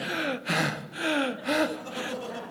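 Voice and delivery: long gasping breaths; acted panting. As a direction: gasp for air after a long run. Runners pant as door opens.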